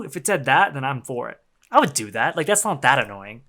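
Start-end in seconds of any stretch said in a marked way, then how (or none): none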